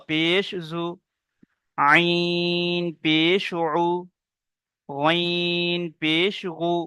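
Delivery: letter names long drawn out and level; slow, measured Qaida drill; spelling recitation. Shown as a demonstration. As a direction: chant each letter slowly, then the short syllable.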